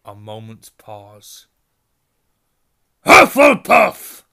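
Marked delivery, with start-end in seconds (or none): 3.0-4.2 huge, slow booming shout, much higher; hat shouts. A narrator's reading. The Sorting Hat announces Hannah's house with a booming shout. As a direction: bellow the house name to the whole hall. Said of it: "shouted the hat".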